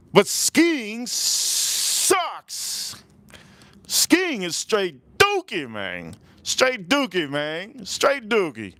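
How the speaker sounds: high-pitched, drawn-out mock rant; exaggerated disgust. Real, not acted. Streamer trashing skiing to the chat room, exaggerated disgust. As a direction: stretch the key words high with comic scorn.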